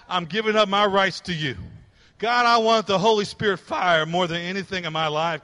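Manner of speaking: emphatic, driving revival sermon; impassioned preaching. Real, not acted. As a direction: urge the congregation on with mounting force. Preaches surrender and restoration at force.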